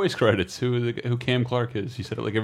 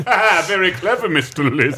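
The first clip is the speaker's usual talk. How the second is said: hammy stage actor; 6 semitones higher than usual. Pretend. Mocking the game's villain lines.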